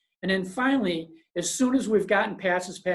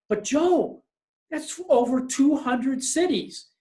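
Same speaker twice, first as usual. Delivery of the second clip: higher, disbelieving salesman's protest; doubting salesman; 7 semitones higher, 3 dB louder, slower than usual. Retold or imitated. Salesman protesting the plan to the boss, in a higher, doubtful voice. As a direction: object to the boss in a raised, unsure voice.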